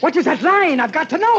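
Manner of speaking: high, loud, desperate demand; frantic shouting. Acted. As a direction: shout it like a man losing his grip. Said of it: "please stop shouting".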